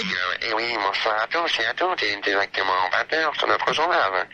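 low, slow puppet news anchor; imitation des Guignols. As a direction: open the evening news as a satirical puppet, low and drawn-out. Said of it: "Je te fais des guignols". (voix grave et lente de marionnette du JT)

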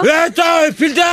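high, agitated calling out; real angry outburst. Real, not acted. Irate host calls on a colleague, angry outburst. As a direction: call a colleague in outrage, voice high. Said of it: "Calme-toi, Apolline".

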